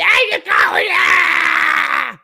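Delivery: high shrieking cheer, hammed-up; mock cheer. Mocking a cheerleader with a shrieking high yell. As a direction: cheer her on in a shrill mock shout.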